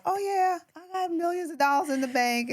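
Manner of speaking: high, breezy mock confidence; imagined boast. Talking to herself at night, lightly hammed-up bragging. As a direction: brag like the money's already there.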